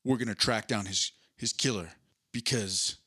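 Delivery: low mock solemn family line; Vin Diesel. Host retelling the movie, mock solemn hero voice. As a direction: sell family with gravity. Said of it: "We're like".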